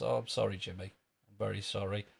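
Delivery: ordinary delivery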